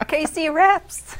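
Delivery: laughing through it, slow. Real, not acted. Co-host teasing him about his rap, laughing. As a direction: tease him while laughing.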